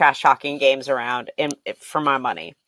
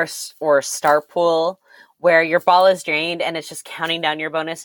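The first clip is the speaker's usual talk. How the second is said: ordinary talk, nothing marked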